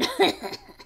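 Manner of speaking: high villain cackle; evil laugh. Acted. Fox cackling at the trapped piglet.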